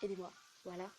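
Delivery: normal talking voice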